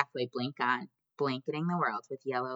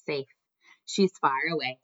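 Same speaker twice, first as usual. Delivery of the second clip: ordinary delivery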